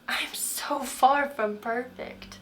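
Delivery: slow, breathy whisper; whispered confession. Real, not acted. Speaker confessing her flaws to viewers in a slow whisper. Admitting her own imperfection.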